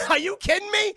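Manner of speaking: very loud, high shout; outraged disbelief. Real, not acted. Host ranting at the father's answer, outraged shout. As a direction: explode in incredulous protest.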